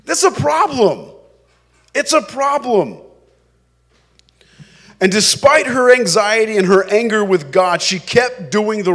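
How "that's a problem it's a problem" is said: normal talking voice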